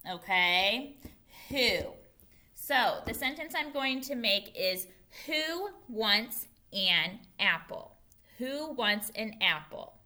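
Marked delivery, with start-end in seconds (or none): 5.3-8.0 slow, separated words. Shown as a demonstration. Teacher models a new question for pupils, word by word. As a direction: say each word on its own, slowly.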